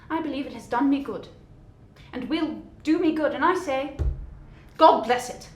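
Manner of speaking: earnest Christmas blessing; sincere cheer. Acted. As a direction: speak from the heart. Nephew earnest speech.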